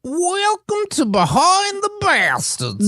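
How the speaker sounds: high, slow, hammed-up show intro; mock announcer intro. Guest asks him to say the welcome, slow mock host voice. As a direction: drawl the title like a cartoon host. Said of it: "you nailed it".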